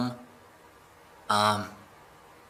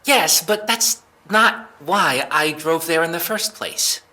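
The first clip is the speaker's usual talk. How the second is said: high, louder, stumbling correction; flustered backpedal; 7 semitones higher, 5 dB louder than usual. Acted. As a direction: trip over your own answer. Suspect caught in a contradiction.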